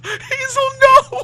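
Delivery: high, loud hammed-up cry; playfully crying out at a friend's joke; mock wail. Game master mock-wailing at Hazel's pirate excuse.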